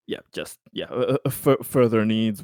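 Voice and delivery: usual speaking voice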